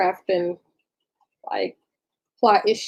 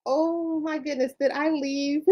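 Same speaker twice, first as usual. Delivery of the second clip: surprised; 6 semitones higher, 7 dB quieter than usual. Real, not acted. Realizes she was unmuted.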